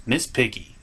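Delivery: ordinary talk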